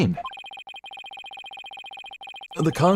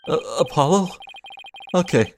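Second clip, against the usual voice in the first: ordinary talk, nothing marked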